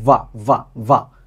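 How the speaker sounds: ওয়া repeated with the w glide stressed; w sound demo. Shown as a demonstration. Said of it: "আমরা পাচ্ছি ডাব্লিউ".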